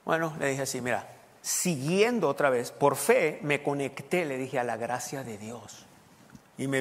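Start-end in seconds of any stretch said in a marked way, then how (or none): 0.8-4.2 slow, emphatic explanation; deliberate answer. Retold or imitated. Pastor answering the curious man, slow and deliberate. 4.6-5.9 slow, softer finish; deliberate answer. Retold or imitated. Pastor explaining his blessing to the man, slow and soft.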